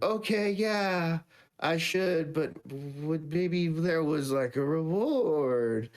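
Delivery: slow, high-pitched fake reluctance; unsubtle hinting. Sister angling for a reward, unsubtly coy. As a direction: drag it out, fishing for something.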